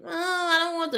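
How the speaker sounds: high-pitched mock whine; whiny child. Mom imitating her daughter's refusal, high whine. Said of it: "like, whiny".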